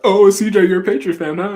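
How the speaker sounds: high, loud teasing jab; mock taunt. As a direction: needle him like a gloating rival fan. Imagined fans taunting him.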